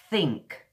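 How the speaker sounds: "think" with a true th, tongue between the teeth; native th. Shown as a demonstration. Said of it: "You put your tongue between your teeth".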